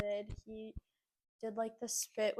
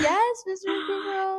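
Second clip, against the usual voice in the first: high, loud, slow laughing reply; respectful reply; 10 semitones higher, 10 dB louder, slower than usual. Retold or imitated. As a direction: answer grandma politely, laughing.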